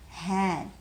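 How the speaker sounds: ordinary delivery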